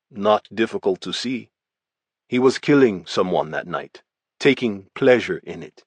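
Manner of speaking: a hint of a Russian accent, blunt and even; lightly voiced Russian gangster, matter-of-fact; Russian gangster giving his statement, lightly accented. Volkov's recorded statement.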